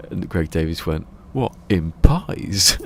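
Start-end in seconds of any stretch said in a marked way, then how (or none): 1.3-2.8 slow, loud disbelieving question; incredulous. Retold or imitated. Greg Davies questioning the taxi driver, slow incredulous. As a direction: stretch it out in disbelief.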